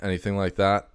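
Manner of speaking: usual speaking voice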